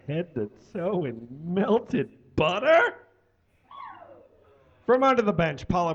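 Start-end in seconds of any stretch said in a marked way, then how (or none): 0.1-2.9 incredulous challenge. Acted. Cook arguing back at the chef, incredulous. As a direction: stretch it out, climbing into a question. Cook's incredulous question ending in rise.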